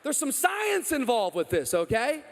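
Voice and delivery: high, loud, slowing into a teasing lecture; loud mock lecture. Pastor to the teen who got his girlfriend pregnant, loud teasing. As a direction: set the kid straight with a grin. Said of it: "I said".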